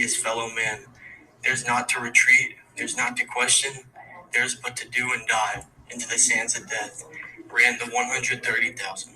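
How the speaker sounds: poem recitation. Acted. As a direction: recite it like a memorial verse, steady and grave. Student recites own war poem.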